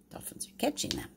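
soft hushed pointing out; whispered aside. Real, not acted. Grandma pointing at the pictures for the babies, whispered. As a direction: whisper it like sharing a secret.

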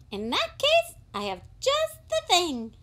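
high, quick shop assistant; a polite high-voiced shopkeeper. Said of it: "said the shop assistant".

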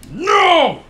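furious high shout of frustration; pure rage. Real, not acted. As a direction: scream it out in real rage. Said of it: "That was pure rage you just heard".

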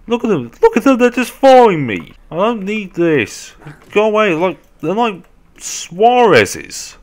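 high, loud complaining cry; exasperated wail. Real, not acted. Player followed by biting zombies, wailing in exasperation. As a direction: wail in disbelief at being chased.

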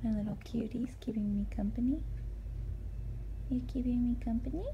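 soft whisper to a pet. Real, not acted. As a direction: whisper sweetly to the little one.

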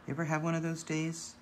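gentle, hushed Mister Rogers voice. Pretend. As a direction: speak softly, like soothing a child. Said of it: "such a soft voice, so calming".

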